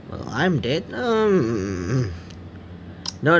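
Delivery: normal talking voice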